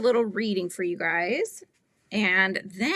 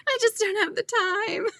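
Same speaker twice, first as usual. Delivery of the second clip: much higher, squeaky mock excuse; a hint of a pleading brother pitched way up; mock whiny brother; 12 semitones higher than usual. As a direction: whine the excuse like a kid. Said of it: "He's like".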